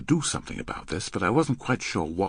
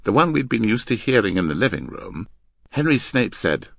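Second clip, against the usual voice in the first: plain reading voice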